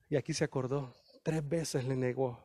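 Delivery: grave, softened telling; hushed. A narrator's reading. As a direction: drop to a near hush at the memory. Peter remembers denying three times.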